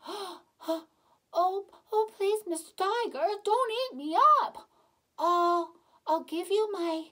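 high, loud, panicky begging; little boy begging a tiger in a storybook; frightened pleading. Acted. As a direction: beg the tiger for your life. Boy begs tiger not to eat him.

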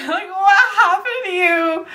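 high question broken by laughter; laughing. Real, not acted. Mocking her own ghoul face, asking while laughing. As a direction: laugh while teasing yourself.

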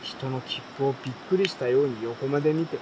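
plain reading voice

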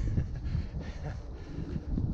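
soft, airy chuckle; helpless little breathy laugh; laughing for no reason. Real, not acted. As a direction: laugh quietly at yourself. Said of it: "I don't know why I'm laughing".

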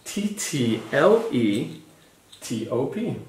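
slow letter-by-letter spelling; slow spelling for a child; spelled out slowly. Shown as a demonstration. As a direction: dictate each letter. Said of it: "spelling for the child".

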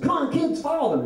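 imitating an eager friend, pitch leaping high; excited calling out. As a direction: call to the kids in a hammed-up high voice. Friend calling kids at parade.

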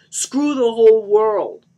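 slow, high, loud bellow; enraged scream. Acted. Johnny curses everyone on his rampage, screaming furiously. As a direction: scream it drawn out in rage. Rampage after betrayal in story.

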